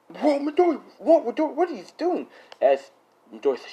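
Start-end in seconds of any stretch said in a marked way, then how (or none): 0.1-2.3 high-pitched frantic questioning; hammed-up high cries of alarm; mock alarm. Classmate to Midoriya as he chokes her, mock alarm.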